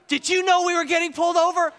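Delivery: high, loud alarmed question; panicked yell. Retold or imitated. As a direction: yell it at the friend in disbelief. Retold panic at police lights.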